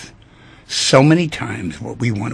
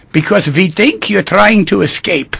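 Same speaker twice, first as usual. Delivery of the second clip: loud, higher German doctor punchline; German accent; 5 semitones higher, 6 dB louder than usual. Acted. German doctor explaining refusal to pilot, in an emphatic German accent. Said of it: "pardon my German accent".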